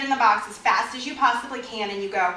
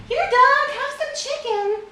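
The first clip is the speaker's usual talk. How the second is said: put-on much higher, louder baby-talk coaxing; coaxing voice to a dog; 7 dB louder, slower than usual. Trainer offering chicken to a fearful dog, high coaxing voice. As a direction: coax the shy dog with a sweet high voice. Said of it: "and I go".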